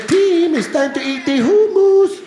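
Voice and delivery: high, loud, drawn-out imitation of his wife; Israeli wife. Wife calling husband to eat, high slow mock wife voice. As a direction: call him to dinner in a piercingly high put-on voice. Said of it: "She says".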